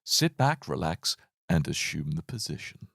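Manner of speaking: low, soft hypnotist's voice; mock relaxation tape, slowing and sinking; Paul McKenna. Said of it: "Paul McKenna".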